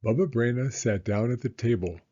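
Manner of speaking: ordinary delivery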